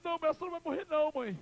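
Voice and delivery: high, rapid wail; acted desperate plea. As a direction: blurt out the refusal in a piercing cry. Son begs dying mother to stay.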